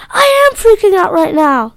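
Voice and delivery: high, loud panicked shout; scream. Acted. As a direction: yell it in panic. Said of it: "Joey screamed".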